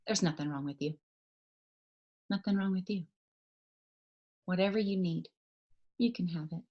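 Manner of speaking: low, soft closing words; hushed and low, letting each line settle; quiet, earnest reassurance. Real, not acted. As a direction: bring it right down and speak gently. Hushed closing reassurance and charge to team.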